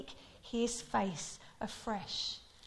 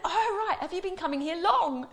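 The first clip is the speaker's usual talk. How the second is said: 1 tourist; 6 semitones higher, 5 dB louder than usual. Retold or imitated. Tourists chatting.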